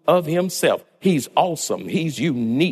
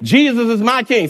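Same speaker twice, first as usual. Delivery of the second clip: louder, higher, with conviction; said like you mean it; 7 semitones higher, 5 dB louder than usual. Shown as a demonstration. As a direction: proclaim it so the room believes you. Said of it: "say it like you mean it".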